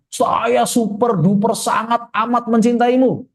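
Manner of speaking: high, slow, hammed-up declaration; husband declaring love. Husband to his wife, over-the-top devotion. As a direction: gush it with every word stretched out. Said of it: "Ustadz Yuda ngomong ke istrinya".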